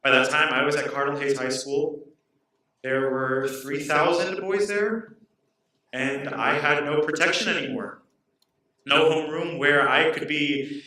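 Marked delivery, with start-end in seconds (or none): none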